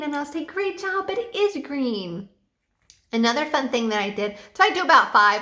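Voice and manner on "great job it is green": put-on much higher, bubbly praise for little kids; enthusiastic praise. Shown as a demonstration. Online teacher praising young students, excited high praise. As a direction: gush over their answer like a cheerleader. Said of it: "you have to be very enthusiastic".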